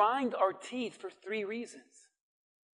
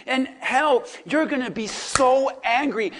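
ordinary delivery

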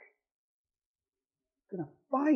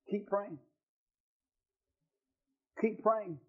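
much softer, gently pleading; quiet urging; 10 dB quieter than usual. Real, not acted. Pastor softly urging church to keep praying. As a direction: lean in and urge them softly.

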